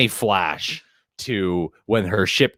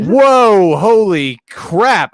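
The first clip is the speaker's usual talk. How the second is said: loud, drawn-out amazement; surprised shout; 9 semitones higher, 8 dB louder, much slower than usual. Real, not acted. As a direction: gasp it out, amazed. Reacts to a donated bird.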